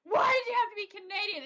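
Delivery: high, whiny cry of disgust; mock disgusted. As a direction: whine it like a betrayed fan. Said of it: "he's so disgusted".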